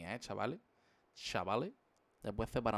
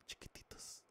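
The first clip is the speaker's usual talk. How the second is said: soft ASMR whisper, fading out; 18 dB quieter, slower than usual. Pretend. Streamer whispers a secret to fans, ASMR style. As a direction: breathe it softly into the mic. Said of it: "Voy a hacer una S M R".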